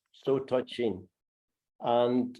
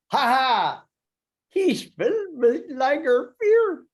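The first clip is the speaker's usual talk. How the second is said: higher, mocking ha ha in German accent; mocking laughter; 11 semitones higher, 5 dB louder than usual. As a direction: jeer like the laughing crowd. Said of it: "This is a German dialect poem".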